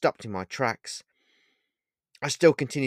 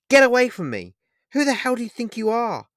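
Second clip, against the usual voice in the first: much higher, loud furious snap; angry snap; 13 semitones higher, 8 dB louder than usual. Acted. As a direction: snap it at him in anger. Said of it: "I snapped with anger in my voice".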